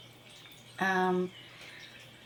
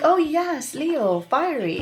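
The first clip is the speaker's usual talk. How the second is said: excited exclaiming; 7 semitones higher, 7 dB louder, slower than usual. Retold or imitated. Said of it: "And I'm like".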